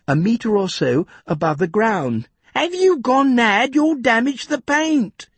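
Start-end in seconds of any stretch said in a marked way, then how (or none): 2.5-5.3 indignant, protecting his machine. A narrator's reading. Jeff protests Tom's spade blow.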